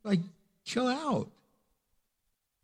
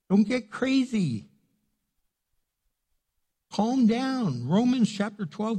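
ordinary talk, nothing marked

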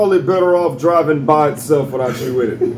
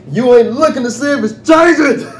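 exasperated shout; 8 semitones higher than usual. Real, not acted. Ranting at bad drivers.

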